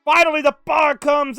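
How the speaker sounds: high, loud excited call; shouting play-by-play. Real, not acted. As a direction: yell it as the game collapses. Player topping out, no bars.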